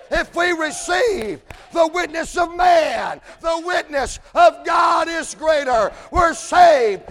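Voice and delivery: loud, high, slow shouted preaching; full-force preaching. Acted. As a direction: rise into a hollering sermon climax.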